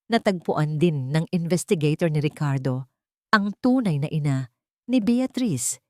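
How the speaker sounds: ordinary delivery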